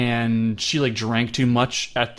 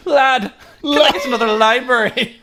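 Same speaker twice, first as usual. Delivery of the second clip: high, louder, laughing girlish mock voice; mock Belle voice; 10 semitones higher, 6 dB louder than usual. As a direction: squeal it out as a giggling caricature. Acting out Belle at library.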